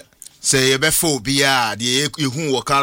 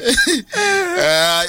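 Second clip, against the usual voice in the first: high helpless laughter; 3 dB louder than usual. Real, not acted. Host cracks up at own joke.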